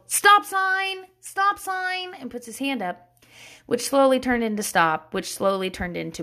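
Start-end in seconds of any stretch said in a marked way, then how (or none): 0.1-2.1 high, held mock yell; imitated toddler yell. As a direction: call it out like a little kid. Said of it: "he yelled".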